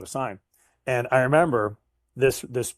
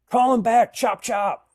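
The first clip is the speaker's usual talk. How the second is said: higher and louder, a hammed-up impatient boss; imitating a boss demanding a callback, higher and louder; mock bossy manager; 12 semitones higher, 7 dB louder, slower than usual. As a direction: bark it like a pushy manager hurrying you. Imitating pushy manager's order.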